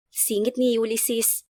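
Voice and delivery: usual speaking voice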